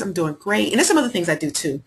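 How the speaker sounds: ordinary talk, nothing marked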